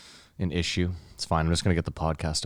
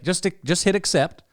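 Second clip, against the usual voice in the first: higher, slowed-down helper voice; retold tech helper; 10 semitones higher than usual. Tech helper talking a customer through a phone step, mimicked. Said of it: "He's like".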